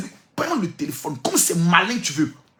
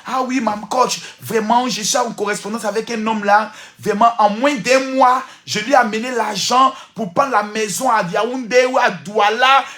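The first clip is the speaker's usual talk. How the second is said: higher, louder, half-sung plea to the coach; imitating a woman's testimony; 5 semitones higher, 6 dB louder than usual. A woman telling the coach about her lover, imitated higher and louder. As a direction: mimic the pleading woman, lightly singsong. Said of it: "j'ai écouté le témoignage d'une femme".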